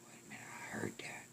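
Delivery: very soft, quick whisper; hushed reply. Acted. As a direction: whisper it under your breath. Companion whispers back in cemetery.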